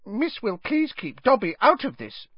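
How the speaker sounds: high, small, timid elf voice; squeaky, anxious little plea; scared mumbling elf. A narrator's reading. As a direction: plead nervously as a tiny house elf. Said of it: "Dobby mumbled, looking scared".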